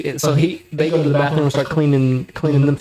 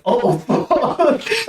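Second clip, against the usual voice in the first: much higher, laughing through it; laughing oh fuck; 9 semitones higher than usual. Real, not acted. Recalling his own reaction, laughing disbelief. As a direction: laugh at the memory.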